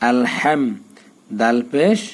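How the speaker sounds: usual speaking voice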